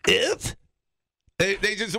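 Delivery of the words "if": high little mock-French yip; French accent. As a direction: chirp it like a confused French tourist. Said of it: "that's the Frenchman in Wisconsin".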